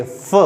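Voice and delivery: फ as a soft puff of light air; soft f sound. Shown as a demonstration. Teacher demonstrates the airflow, soft airy f for फ. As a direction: breathe फ out gently as an f. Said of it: "हल्की सी हवा निकलनी चाहिए".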